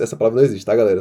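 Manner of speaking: normal talking voice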